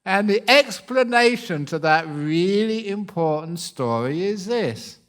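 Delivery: ordinary talk, nothing marked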